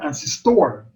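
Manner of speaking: "ancestor" as an-ces-TOR; wrong stress demo. Shown as a demonstration. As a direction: misplace the stress onto the last syllable of "ancestor". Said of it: "We don't say".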